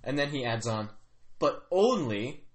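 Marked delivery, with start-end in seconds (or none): 1.4-2.4 "But only" drawn out, left hanging; unfinished sentence. Acted. Kyrak adding a condition, "But only" left unfinished. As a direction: stretch "only", then break off. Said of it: "he doesn't finish his sentence".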